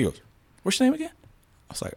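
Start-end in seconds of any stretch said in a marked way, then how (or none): 0.6-1.1 high-pitched excited imitation of the cop; mock squeal of recognition; squeaky high mock question. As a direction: pitch it way up, half-joking. Retold cop asks his name.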